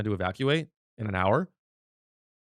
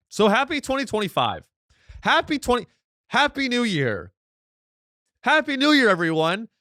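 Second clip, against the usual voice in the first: mock cheerful greeting; 10 semitones higher, 4 dB louder than usual. Sarcastic new year greeting.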